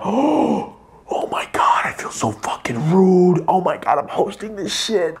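high, breathy panicked whisper. Retold or imitated.

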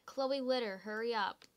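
ordinary talk

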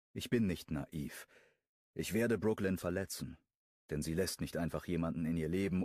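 ordinary delivery, nothing marked

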